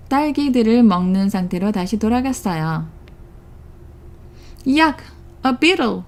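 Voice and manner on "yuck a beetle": disgusted. A narrator's reading. Wolf disgusted eating bugs.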